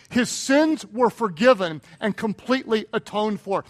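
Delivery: ordinary talk, nothing marked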